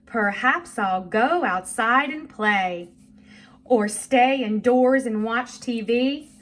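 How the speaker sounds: slow, deliberate read-along; measured poem reading for young learners; reading a poem aloud. Shown as a demonstration. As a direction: read it slowly to a child, stressing the words. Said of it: "first I'm gonna read this to you".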